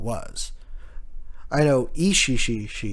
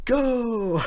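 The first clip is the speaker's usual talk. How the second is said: much higher playful cry; gleeful shout; 11 semitones higher than usual. Retold or imitated. Luffy bouncing the attack back at Kaido, gleeful much higher cry. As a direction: launch it back with glee. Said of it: "And he goes".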